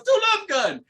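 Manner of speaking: very high-pitched retold order; acted-out excited command; eager demand. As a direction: urge it in a high, insistent voice. Retelling drummer insisting on a song.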